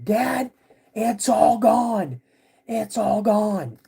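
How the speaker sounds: high, slow, panicked yell; scared yelling. Retold or imitated. Scared kid yells to his dad that the food is gone, high panicked yell. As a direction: cry out to dad in alarm, stretching the words. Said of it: "I just start yelling".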